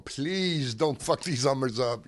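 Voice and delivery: high, drawn-out plea; acted-out worried begging; retold pleading. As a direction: beg with your job on the line. He begs, fearing for his job.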